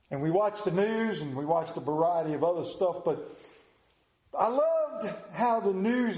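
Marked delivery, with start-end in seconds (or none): none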